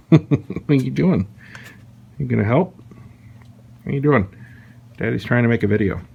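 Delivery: high playful dog talk. Real, not acted. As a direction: coo at the puppy. Talking to his dog.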